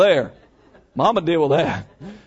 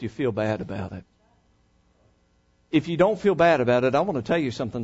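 normal talking voice

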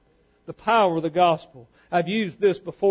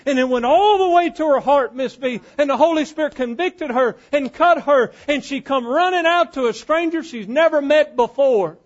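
raised pitch, driving pulpit cadence; fervent preaching; 9 semitones higher, 7 dB louder than usual. Acted. Preacher tells how the woman was convicted, fervent preaching. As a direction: build it into full-voiced, urgent preaching.

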